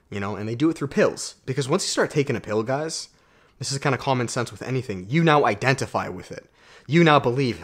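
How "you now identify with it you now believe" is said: ordinary talk, nothing marked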